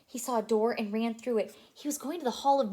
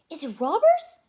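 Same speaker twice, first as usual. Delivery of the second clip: high, loud squeaky Mickey Mouse voice; excited squeaky mouse voice asking the dog; high eager Mickey; 12 dB louder than usual. Acted. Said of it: "Mickey asked".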